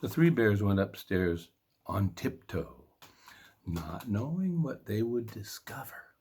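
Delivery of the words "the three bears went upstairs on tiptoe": plain reading voice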